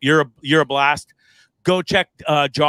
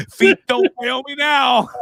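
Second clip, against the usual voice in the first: mock panic; 10 semitones higher than usual. Mock fleeing, laughing at memory.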